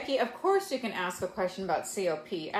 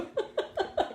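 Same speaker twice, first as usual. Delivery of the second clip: high squeal of delighted laughter. Real, not acted. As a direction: burst out in a squealing giggle. Squeals at Sasshole comment.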